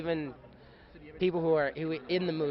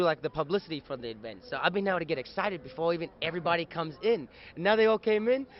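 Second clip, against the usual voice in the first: ordinary delivery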